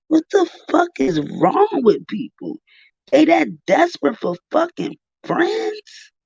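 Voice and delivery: can't believe what they just heard; exasperated disbelief. Real, not acted. Exasperated reaction to the call.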